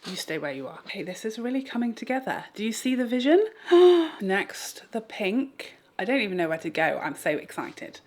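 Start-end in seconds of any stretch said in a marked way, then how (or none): 3.5-4.2 sharp, delighted gasp, much higher and louder. Real, not acted. Vlogger shows viewers the look, gasping loud. Reacting to her own look.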